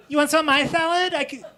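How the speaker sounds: high, fast, loud offer; eager pleading. Acted. Boss pressing salad on a coworker, high and frantic. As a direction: rush it out in a high voice.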